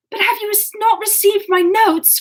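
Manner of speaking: high, urgent, anxious cry; wildest anxiety. A narrator's reading. Marianne questioning Willoughby, wildly anxious. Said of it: "in the wildest anxiety".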